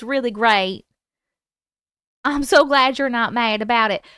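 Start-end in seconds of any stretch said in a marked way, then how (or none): none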